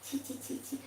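unvoiced whisper "ছি"; whispered shame. Real, not acted. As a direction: hush it, embarrassed. Embarrassed before viewers. (ফিসফিস করে লজ্জায় "ছি ছি")

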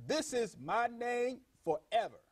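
quoted divine pronouncement, deliberate and high; emphatic declaration. Retold or imitated. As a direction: proclaim it slowly, stretching the words. Voices God declaring his name.

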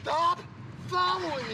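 angry yell. Real, not acted. Driver confronting the filming man.

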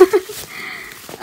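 nervous laugh. Real, not acted. After apology.

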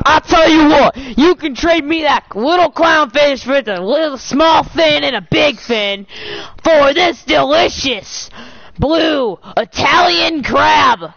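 crab voice. Pretend. Crab haggling to trade fish.

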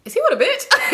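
very high, indignant mock outburst; acted annoyance. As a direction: replay the jealous irritation, pitch shooting up. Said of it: "I'm kinda getting annoyed".

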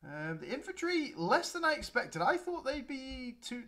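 ordinary talk, nothing marked

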